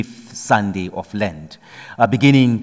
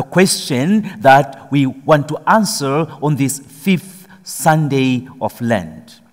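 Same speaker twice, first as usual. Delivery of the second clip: rising sermon fervour; preaching; 4 semitones higher, slower than usual. Acted. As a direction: press the question on the congregation. Preacher building up.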